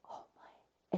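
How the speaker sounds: breathless whispered oh my; awed whisper. Retold or imitated. Retelling a woman seeing the light, whispered awe. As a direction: hush it out in awe.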